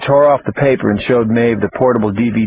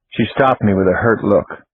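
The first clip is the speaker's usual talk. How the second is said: ordinary delivery, nothing marked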